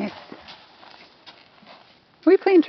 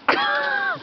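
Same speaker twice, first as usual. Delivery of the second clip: high giggle; 4 dB quieter than usual. Real, not acted. Laughing at horse.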